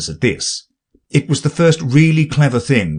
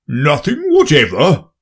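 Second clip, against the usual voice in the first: booming king; pompous king, loud and grand; 6 semitones higher, 3 dB louder than usual. Acted. King presses Alice.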